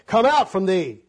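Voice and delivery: loud, pompous commanding voice; mocking a showy exorcism; mock exorcist. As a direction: ham up a self-important exorcist. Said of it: "go out and say".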